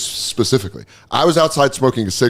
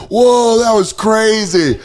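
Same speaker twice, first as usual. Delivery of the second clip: fake innocence; 9 semitones higher, 3 dB louder, much slower than usual. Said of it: "we were like".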